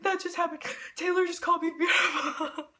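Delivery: high, breathless mock hyperventilation with laughter; nervous, breathing heavily. Fan acting out her delayed shock, nervous heavy breathing. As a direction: gasp it out in overwhelmed disbelief. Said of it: "breathing heavily and nervously".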